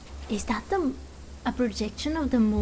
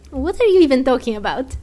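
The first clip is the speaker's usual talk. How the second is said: louder and quicker, exasperated; baffled outburst; 7 dB louder, faster than usual. Real, not acted. Streamer to cryptic villain, loud exasperated outburst. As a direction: throw up your hands at the riddle.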